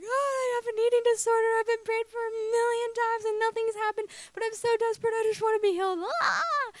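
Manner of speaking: high-pitched, hammed-up wail; imitating desperate blubbering, way up high; mock bawling. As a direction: wail it like an exaggerated sobbing fit. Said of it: "like, bawling".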